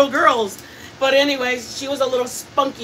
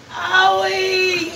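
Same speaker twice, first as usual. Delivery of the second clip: put-on high, laughing "Owie!" like a hurt kid; mock hurt child; 8 semitones higher than usual. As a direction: playfully imitate a fallen girl's cry. Voicing hurt niece in retelling.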